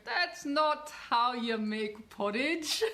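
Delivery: slow, playful attempt at a Scottish accent. Imagined Scots viewers objecting to her porridge, mock Scottish accent. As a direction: put on an amateur Scottish accent, drawn out. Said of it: "that's the best Scottish accent I can do".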